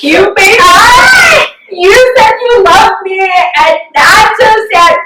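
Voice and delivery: high, slow, wailing sob-voice; hammed-up crying, voice pitched high and drawn out; mock sobbing wail. Sobbing on phone to mom.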